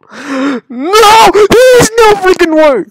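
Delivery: loud wail climbing high; frustrated screaming. Real, not acted. Gamer denying the level can be done, frustrated screaming. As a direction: scream in outraged disbelief. Fails in rage game.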